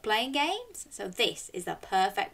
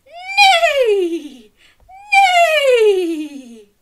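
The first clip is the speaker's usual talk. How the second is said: high, loud, laughing neigh; whinny like a horse with a laugh; 14 dB louder than usual. Shown as a demonstration. Host neighs like a horse for the children, high and laughing. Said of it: "can you neigh like a horse?".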